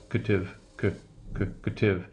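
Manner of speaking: cative broken down as "kuh-tiv"; schwa in cative. Shown as a demonstration. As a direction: drill the weak "kuh" in cative, piece by piece. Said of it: "pronounced as the reduced value as a schwa".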